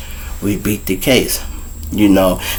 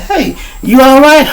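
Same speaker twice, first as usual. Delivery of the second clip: concerned cop; 14 semitones higher than usual. Retold or imitated. Cop calls to a man walking by, high-pitched mimicry. Retelling the cop checking on him.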